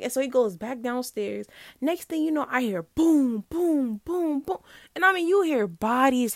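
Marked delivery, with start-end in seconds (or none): none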